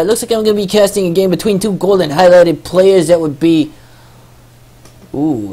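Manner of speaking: hyped ring announcer voice; boxing announcer. Acted. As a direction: announce them like a prize fight. Announcer intro of players.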